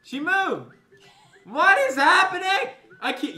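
high squealing laughter; laughing in disbelief. Real, not acted. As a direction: laugh it out, shocked. Character suddenly moved.

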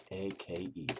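usual speaking voice

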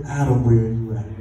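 low, drawn call; Adam, where are you at, said low and steady; call phrase. Shown as a demonstration. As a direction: model the line for the crowd to repeat. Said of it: "Everybody say".

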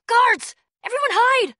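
high loud warning call; alarm shout. Acted. Warning her friends that guards are coming. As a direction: shout the alarm to scatter everyone.

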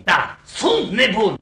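slow, loud theatrical confession; declaimed lover's madness; impassioned declaration. Acted. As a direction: proclaim it with melodramatic force. Suitor declares his mad love.

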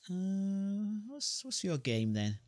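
hesitant, slow wary question; suspicious hero. Acted. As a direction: eye the creature warily and ask.

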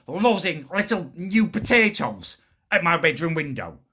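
ordinary talk

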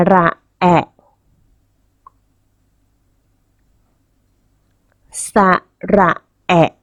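สระแอะ in spaced syllables, short final แอะ; model pronunciation. Shown as a demonstration. Teacher asks children to repeat after her, model pronunciation of short สระแอะ. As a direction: say สระแอะ carefully, cutting the last vowel short. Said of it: "เด็กๆ พูดตามคุณครูเลยนะคะ".

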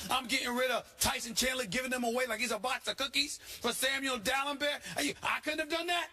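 high-pitched, worked-up sports rant; incredulous, pitch climbing as the tirade builds; exasperated rant. Real, not acted. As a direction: rant in indignant disbelief at a team's bad moves. Worked-up rant about Knicks trades.

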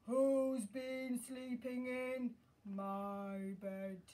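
long drawn-out, loud bear; Daddy Bear's big voice. Acted. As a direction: boom it out slowly as a huge father bear. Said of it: "in his big voice".

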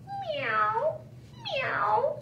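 very high meow; cat meow. Acted. Teacher voicing Cat in the story, high meow. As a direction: meow like a kitten. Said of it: "said Cat".

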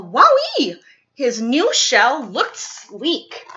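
plain reading voice

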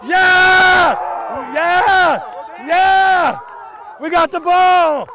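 loud, triumphant yeahs; celebrating yell. Real, not acted. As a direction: cheer the turnover at full voice. Team celebrating turnover.

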